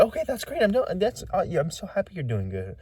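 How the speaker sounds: very high, gushing chatter; imitating the woman's overeager happy reply. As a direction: gush in a strained high voice. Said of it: "And she was like".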